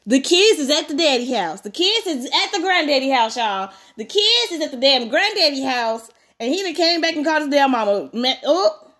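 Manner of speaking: animated, incredulous aside; exasperated outburst. Real, not acted. Reader reacting to kids at granddaddy's house, exasperated. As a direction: vent it to the listeners, drawing out the complaint.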